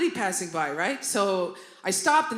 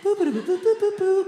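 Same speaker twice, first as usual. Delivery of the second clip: high, playful scat boops; imitated jazz; 10 semitones higher than usual. Daughter calming down with jazz radio, high scatted boops. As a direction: boop out a little jazz riff. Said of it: "listen to Jazz F M".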